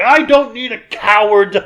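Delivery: retold angry outburst; indignant retort. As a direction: snap back as a proud warrior. Acts Zed's angry retort.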